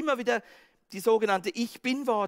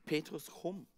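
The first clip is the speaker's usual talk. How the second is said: much softer, tender call; gentle invitation; 3 semitones lower, 8 dB quieter than usual. Acted. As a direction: open arms and softly invite.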